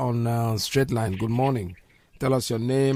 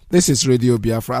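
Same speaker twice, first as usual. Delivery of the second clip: loud announcement; 10 dB louder than usual. Real, not acted. Station ident called out.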